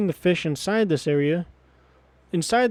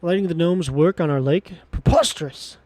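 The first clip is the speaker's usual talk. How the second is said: haughty refusal, punching key words; outraged character voice with sudden peaks; indignant merman. Acted. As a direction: scoff like an offended ruler. Voicing indignant merman king rejecting gnomes.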